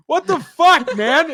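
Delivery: high, exasperated outburst; indignant outburst. Retold or imitated. Retelling protest to a teammate who shot him, indignant. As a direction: demand an explanation, pitch jumping up. Acted outrage at friendly fire.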